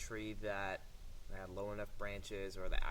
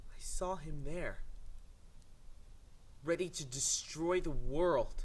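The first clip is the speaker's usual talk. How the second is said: mock horror drama; 5 semitones higher than usual. Hammed-up horror tale.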